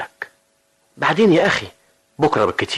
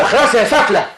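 a sharp, raised insult; angry snap; 8 semitones higher, 4 dB louder than usual. Acted. Fawzy insulting the girl in front of her mother, angry. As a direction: spit the insult out in anger.

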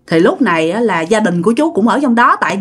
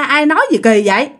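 ordinary delivery

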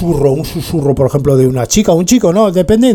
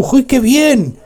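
high-pitched, gushing mock delight; hammed-up eager yes, pitched well up; delighted acceptance; 8 semitones higher than usual. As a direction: play someone instantly won over, eagerly agreeing. Acts out delighted reply to French.

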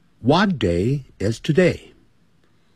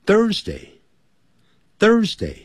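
Thursday drawn out slowly, set higher; slow model reading; 9 semitones higher, much slower than usual. Shown as a demonstration. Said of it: "我们现在就跟着讲".

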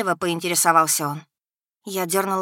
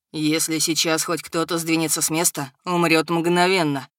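thunderous dragon; 3 semitones lower than usual. A narrator's reading. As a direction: command the room like a dragon. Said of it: "Голос дракона звучал оглушительно". (оглушительный голос дракона)

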